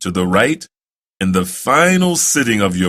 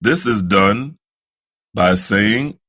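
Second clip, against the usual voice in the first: ordinary talk, nothing marked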